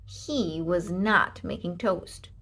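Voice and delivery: plain reading voice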